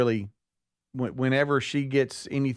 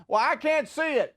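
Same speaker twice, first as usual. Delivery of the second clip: whining mom. Retold or imitated. Mom complaining on phone.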